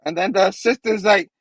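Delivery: usual speaking voice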